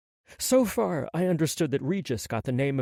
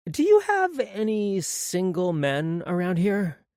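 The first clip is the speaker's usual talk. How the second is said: a slow, lightly higher Maggie voice; piped up; 7 semitones higher, 3 dB louder, slower than usual. A narrator's reading. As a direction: pipe up brightly as Maggie. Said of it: "Maggie piped up".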